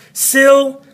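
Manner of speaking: syllable broken up, SYL high and loud; stress on first syllable. Shown as a demonstration. Teacher asking students to hear the difference, first syllable stressed. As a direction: punch SYL high, let the rest drop. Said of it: "hear the difference in my voice?".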